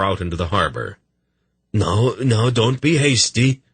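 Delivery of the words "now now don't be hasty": nervous. Acted. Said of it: "backing fearfully away".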